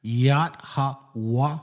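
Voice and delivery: "Yahweh" sounded out as yat, ha, wa; demonstrated pronunciation. Shown as a demonstration. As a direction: break "Yahweh" into three separate open syllables, yat-ha-wa. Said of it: "Like that".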